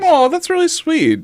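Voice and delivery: high, slow touched aww; tender aw. Real, not acted. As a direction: coo like you're moved. Touched reaction.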